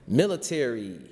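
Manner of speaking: ordinary delivery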